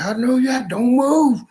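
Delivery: high, loud panicky warning; shouted command. Retold or imitated. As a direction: shout it high at intruders outside. Acted challenge to people outside.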